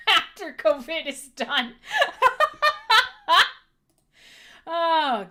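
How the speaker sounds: words breaking into loud laughter; laughing. Real, not acted. Joking about being found under glass, laughing hard. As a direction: laugh through the punchline.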